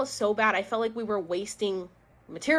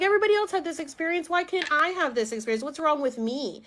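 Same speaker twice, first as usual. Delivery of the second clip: higher, rushed retold self-pity; frustrated complaint; 6 semitones higher, faster than usual. Blaming herself for missing the experience, frustrated. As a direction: plead it fast and high, exasperated.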